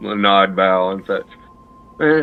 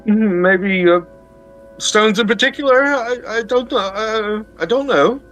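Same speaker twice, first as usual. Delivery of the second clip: high, hesitant stammering voice; nervous servant stammering upward; 9 semitones higher than usual. Acted. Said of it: "he stammers a little bit and says".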